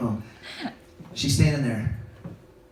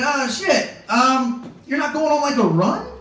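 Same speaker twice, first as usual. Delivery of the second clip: stoned pleading; 9 semitones higher than usual. Retold or imitated. Stoned hopeful question.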